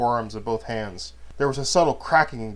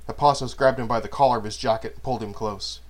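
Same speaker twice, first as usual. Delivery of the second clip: ordinary delivery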